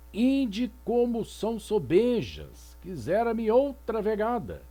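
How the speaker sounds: teacher reciting a medieval song; poem recitation. Shown as a demonstration. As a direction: read the lament aloud like a poem.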